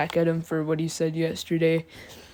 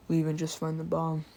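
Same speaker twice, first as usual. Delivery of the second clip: usual speaking voice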